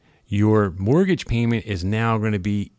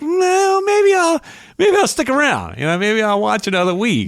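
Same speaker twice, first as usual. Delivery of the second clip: much higher, falsely hopeful voice; mock hopeful fan; 12 semitones higher than usual. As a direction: talk yourself back in, pitched way up. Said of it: "You're like".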